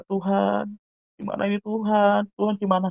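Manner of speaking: high-pitched pleading to God; retold anxious prayer. Praying through a sleepless night before facing father, mildly anguished. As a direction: plead upward, helpless, re-enacting a worried prayer.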